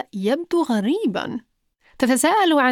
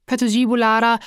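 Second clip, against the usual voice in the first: usual speaking voice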